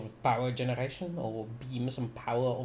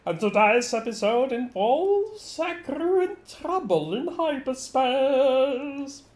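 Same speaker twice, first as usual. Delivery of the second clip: robotic, higher and louder broadcast voice; 8 semitones higher, 6 dB louder than usual. Real, not acted. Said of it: "And my voice is, for some odd reason, weird".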